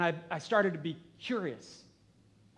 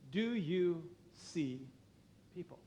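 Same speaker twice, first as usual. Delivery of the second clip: slow pointed question; 6 dB quieter, slower than usual. Acted. Pastor poses key question slowly.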